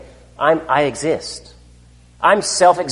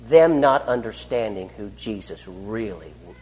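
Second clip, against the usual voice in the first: ordinary delivery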